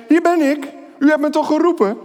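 put-on a small boy hurrying to Eli; eager boy. A narrator's reading. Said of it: "ging naar Eli en zei".

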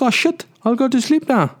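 put-on frightened kid. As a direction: squeak like a scared little kid.

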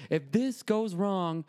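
slow, high stern warning; stern doctor. Retold or imitated. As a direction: warn him gravely and slowly. Doctor's big threat.

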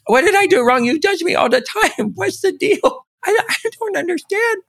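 the body as a wounded puppy pleading; squeaky, hurt and baffled, with a laugh. Retold or imitated. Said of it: "They're like".

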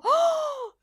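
high, loud drawn-out "oh"; astonished gasp. Retold or imitated. Preacher reliving her shock at the patient's healing, astonished cry. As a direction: re-enact a stunned, high-pitched cry of amazement. Acts out her stunned reaction.

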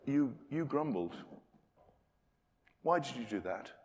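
a hushed, disappointed God asking why; quiet grieved reproach, not angry; God questioning. Retold or imitated. God asking the grumbler to account, softly reproachful. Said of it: "God will say".

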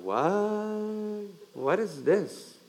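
mock baffled brothers; hushed, slow mock confusion. Pastor voicing stunned brothers, quietly baffled. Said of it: "And they're going".

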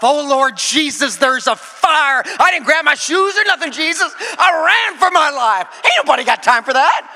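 high, loud panicked Sweet Brown, "Oh, Lord Jesus" slow and drawn; Sweet Brown impression. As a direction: yell it like you just fled a fire in your socks. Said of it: "This sweet brown lover".

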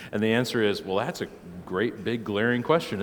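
ordinary talk, nothing marked

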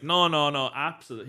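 imitating a drunk friend brushing it off; loud, insistent mock-drunk reassurance; hammered friend. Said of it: "He was absolutely hammered".

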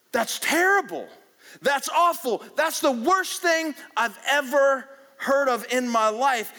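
loud, high outraged exclamation; David erupting at an awful story; outraged king. Retold or imitated. David getting mad, acted.

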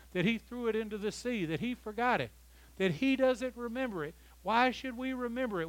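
high-pitched, driving sermon delivery; impassioned preaching. Real, not acted. Preacher urging the church to put on the new self, impassioned. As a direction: preach it hard, piling phrase on phrase.

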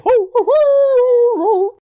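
very high, loud, slow howling; dog howl impression. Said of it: "That was also very good".

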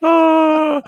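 loud high cry of mock anguish. Real, not acted. Host crying out after being teased.

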